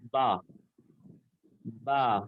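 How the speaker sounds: با with both wet lip parts pressed then released; letter sound demo. Shown as a demonstration. Teacher showing class how با leaves the lips, clear demo. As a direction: pop out با from closed lips, twice. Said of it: "اور پھر sound نکالیے".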